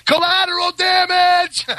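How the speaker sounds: high, drawn-out comic shout; yelled out the window. Acted. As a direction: holler it slowly as if from an aircraft window. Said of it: "probably just yelled out the window".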